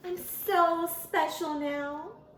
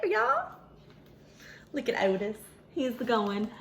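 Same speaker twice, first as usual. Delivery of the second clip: ordinary delivery, nothing marked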